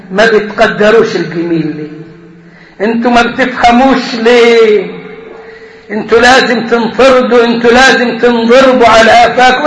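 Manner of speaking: ordinary talk, nothing marked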